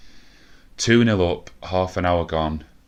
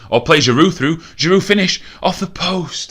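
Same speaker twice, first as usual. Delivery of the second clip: much higher, louder build-up as the striker shoots; rising excitement; 11 semitones higher, 9 dB louder than usual. Real, not acted. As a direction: climb with the chance then groan at the post. Chance builds, hits the post.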